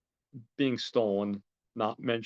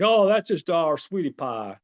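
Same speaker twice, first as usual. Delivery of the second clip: defensive; 6 semitones higher, 8 dB louder than usual. Retold or imitated. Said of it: "defends himself".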